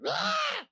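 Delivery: very high-pitched reenacted scream. Pastor reading her healing email, acted scream. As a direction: let out a sudden high shriek of joy at the news. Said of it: "I screamed. as loud as I could".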